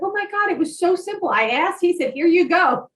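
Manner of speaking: high, fast, amazed retelling; quick, high-pitched mock amazement; imitated excitement. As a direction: gush it like a thrilled friend reporting back. Acted texter amazed it was simple.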